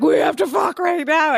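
high, insistent and hammed-up; mock urgent demand. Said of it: "And I'd be like".

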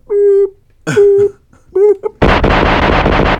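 high loud beep beep beep; backing-up beeps. Retold or imitated. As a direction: beep like a reversing truck. Imitating truck backing up.

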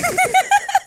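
squealing, very high laugh. Real, not acted. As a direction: let out a high-pitched laugh. Laughing after karaoke joke.

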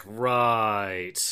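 long drawn-out "right"; acted reluctant recruit. Woman answering the KGB man's threat, drawn-out acted reply. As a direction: stretch the word out in wary acceptance. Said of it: "she's like".

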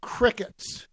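"Crickets" dropped as a dry joke, loud and high; a mock-deadpan crickets to the silent room. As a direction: land the punchline dryly. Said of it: "I look at them and I said".

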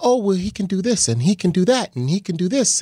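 lightly imitating wife, easygoing; breezy wife. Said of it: "so she's like".

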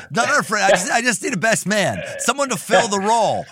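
playful put-on pleading; mock impersonation. Mockingly voices the guest's motive.

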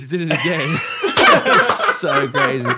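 high, loud laughter spilling into words; real laughing fit; laughing hard. Real, not acted. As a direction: crack up mid-story. Helpless laughing while retelling.

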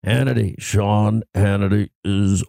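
low, slow just-woke-up announcer impression; morning-voice drop. Host mimicking his friend's early morning show drops, deep morning voice. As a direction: imitate a deep, drawn-out radio drop. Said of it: "it has that morning voice deepness to it".

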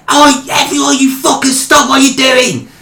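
mock Birmingham boss yelling at staff; high Birmingham accent, shouting at the warehouse; Birmingham manager. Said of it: "I can't do a Birmingham accent".

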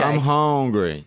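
put-on slack-tongued, like a Louisiana kid to mama; lazy Louisiana accent. Shown as a demonstration. Showing how hometown folks talk to mama, lazy Louisiana accent. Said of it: "It's very lazy".

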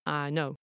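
I said as a flat "ah" in "I know"; single unbending "ah" vowel on I; North Yorkshire vowel. Shown as a demonstration. Narrator demonstrating North Yorkshire I to listeners, flat vowel. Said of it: "the North Yorkshire I is closer to ah".

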